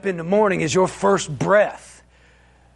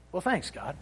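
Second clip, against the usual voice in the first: wry thanks; 3 semitones lower, 13 dB quieter than usual. Retold or imitated. Dry reply to God.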